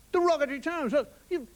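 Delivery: ordinary delivery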